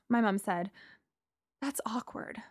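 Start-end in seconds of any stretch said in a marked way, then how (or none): 1.6-2.4 unvoiced, hushed aside; whisper. A narrator's reading. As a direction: whisper it like a secret. Mom reacts to ex's place.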